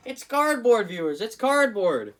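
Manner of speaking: loud outraged exclamation; exasperated yell. Real, not acted. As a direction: shout it in disbelief at the cardboard. Exasperated about cardboard clock back.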